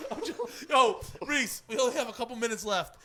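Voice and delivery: ordinary delivery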